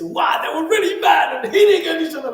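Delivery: high, loud, astonished exclamation; mock shock. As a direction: gasp it out like a shocked reader. Imitating a shocked Bible reader.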